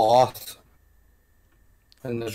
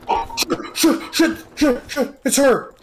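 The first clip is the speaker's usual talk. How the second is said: frightened cursing; 14 semitones higher, slower than usual. Real, not acted. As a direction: panic as she appears. Spotting the monster.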